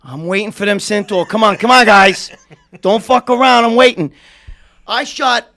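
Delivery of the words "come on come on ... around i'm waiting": loud, impatient calling out; impatient shout. Real, not acted. Host loudly urging the crew to get ready. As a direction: hurry them up, calling out loud.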